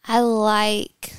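usual speaking voice

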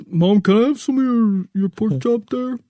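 Riley's dog voice. Acted. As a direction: beg for the pork chop as the dog. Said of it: "I'll do the Riley voice".

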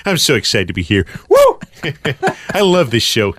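overexcited American star, laughing and whooping; Tom Cruise. Acted. Film star thrilled to be on the show, Tom Cruise impression. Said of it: "It's Tom Cruise".

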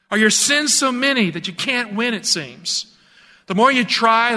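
pastor's rising call to the listeners; fervent preaching. Acted. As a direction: plead with the congregation to choose Jesus.

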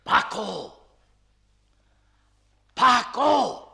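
shouting down the stairwell; calling out. Acted. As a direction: call out loudly.

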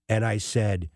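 normal talking voice

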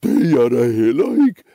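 loud, mouthy mumble with swooping pitch; put-on voice for the middle goat; middle goat mumbling. Middle goat denying fear, loud put-on mumble. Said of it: "mumlede den mellemste".

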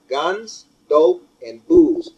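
each word hammered, slow and loud; Guns, dope, and booze, slow and punched; slow emphatic list. Real, not acted. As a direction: spell out the three evils one by one. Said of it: "In that order".

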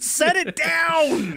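high, very slow mock order; retold command. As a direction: drag out a hammed-up demand. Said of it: "and I'm like".

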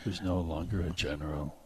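normal talking voice

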